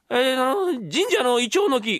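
high, stalling son voice; put-on voice, hesitant then blurting; imitating the young master. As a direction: bluff a wild guess in the mimicked voice. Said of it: "俺の声色がうまい".

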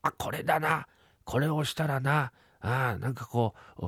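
ordinary talk

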